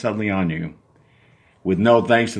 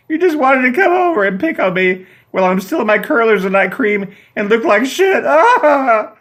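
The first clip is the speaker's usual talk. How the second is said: high, loud tearful shriek; crying rant; 12 semitones higher, 4 dB louder than usual. Acted. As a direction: wail it through tears. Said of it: "She begins to cry".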